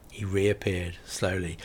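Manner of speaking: ordinary delivery, nothing marked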